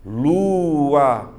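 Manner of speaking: "lua" held long and loud as lu-a; syllable separation. Shown as a demonstration. Teacher repeats the hiatus for the class, "lua" stretched into separate syllables. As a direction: sound out "lua" slowly, giving each vowel its own syllable. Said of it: "tem que saber separar".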